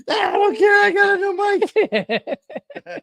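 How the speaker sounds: very high, loud squealed delight; hammed-up falsetto excitement; mock giddy glee. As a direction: squeal it like an overexcited kid. Acted gleeful reply to big news.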